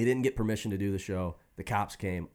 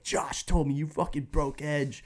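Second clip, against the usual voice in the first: higher, crying teen rant, hammed up; tearful rant; 9 semitones higher than usual. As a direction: replay the tearful teenage outburst, pitched up. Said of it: "So I'm crying".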